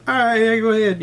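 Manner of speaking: high, easygoing imitation; obliging reply. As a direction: wave them through vocally. Imitates obliging drivers responding.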